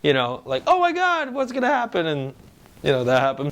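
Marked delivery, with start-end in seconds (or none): 0.7-2.1 much higher, excited fan squeal; imitating fans losing it over a theory; raving fan. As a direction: gush like a hyped fan. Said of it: "we're all raving".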